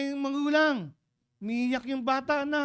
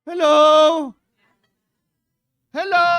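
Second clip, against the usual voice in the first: loud rousing hello; calling out; 6 semitones higher, 15 dB louder than usual. Real, not acted. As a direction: wake up the crowd. Calling congregation to attention. (malakas na pagtawag sa kongregasyon)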